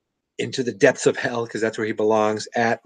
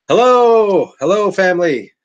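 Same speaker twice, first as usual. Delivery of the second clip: excited hello; 7 semitones higher, 7 dB louder than usual. Real, not acted. Greeting Gary's family on stream.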